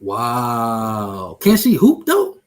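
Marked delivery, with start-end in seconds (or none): 1.4-2.4 much higher, louder playful rise; tease, squeaking up on though; teasing question. Real, not acted. Teasing question about the announcer.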